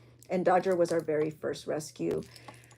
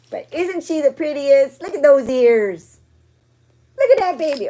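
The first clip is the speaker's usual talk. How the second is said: high, cooing and louder; 7 semitones higher, 11 dB louder than usual. Real, not acted. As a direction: gush over the cute dog. Gushing over dog photo.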